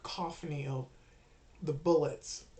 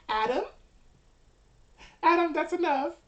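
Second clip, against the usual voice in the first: high acted calling to a friend; alarmed bystander; 11 semitones higher, 7 dB louder than usual. Game master voices someone finding Adam dead, high-pitched alarm. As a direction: call out high, from loud scolding to worried question.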